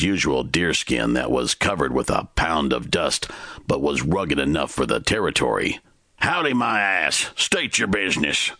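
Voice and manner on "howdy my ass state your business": barked. A narrator's reading. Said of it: "the man barked at him".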